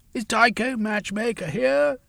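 high call across the crowd; crying out. A narrator's reading. Velveen calls out to the workers for Tycho. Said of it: "he cried out".

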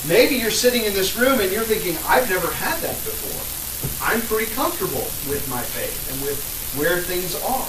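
loud, rising sermon oratory; impassioned preaching. Real, not acted. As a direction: preach it hard to the room. Preaching at full force after mic.

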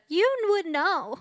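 high, slow and loud, breaking into laughs; laughing punchline. Pretend. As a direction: hit the joke high and laugh through it.

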